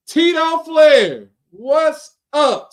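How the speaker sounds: big, drawn-out announcer-style shout-out; hyped introduction. Real, not acted. Host welcomes the predictions champion, hyped introduction. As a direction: call the name out high, loud and stretched.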